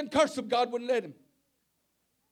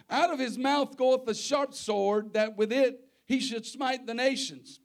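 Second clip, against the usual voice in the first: preaching; 3 semitones higher, 8 dB louder than usual. Acted. Reads scripture at full force.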